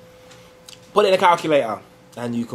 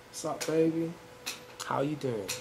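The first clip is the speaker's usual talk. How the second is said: Joey; 15 dB quieter than usual. Acted. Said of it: "your Joey voice on".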